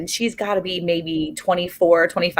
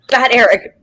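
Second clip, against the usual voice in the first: laughing mock scold; 3 semitones higher, 6 dB louder than usual. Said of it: "No, I wanted to say".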